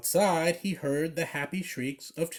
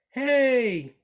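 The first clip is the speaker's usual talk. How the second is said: a higher, louder group yell; 9 semitones higher, 5 dB louder than usual. A narrator's reading. Kids yelling at the dog with their ball, high loud yell. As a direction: call it out loudly like a crowd of kids. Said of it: "yelled the kids".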